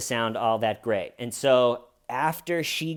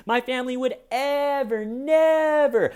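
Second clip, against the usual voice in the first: very high, louder "ever, never"; mock shrill denial; 12 semitones higher, 6 dB louder than usual. Parody clip, Wendy squealing her denial. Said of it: "really funny video".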